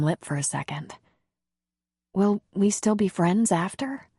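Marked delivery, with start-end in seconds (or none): none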